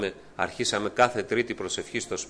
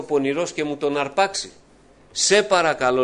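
higher and louder desperate begging; urgent fearful plea; 6 semitones higher, 7 dB louder than usual. Retold or imitated. Praying to the Lord, begging him urgently, louder. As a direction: cry out the plea, building. Retold fearful night prayer.

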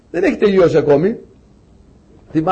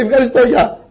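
laughing, pitched-up goodbye; cheerful getaway through a laugh; gleeful thanks; 6 semitones higher, 3 dB louder than usual. Retold or imitated. Soldier leaving happily, teller laughing.

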